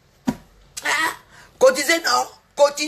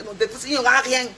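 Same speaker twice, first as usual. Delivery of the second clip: ordinary delivery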